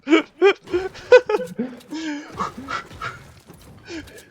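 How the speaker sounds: big high burst of laughter; loud high laugh. Real, not acted. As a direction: crack up loudly.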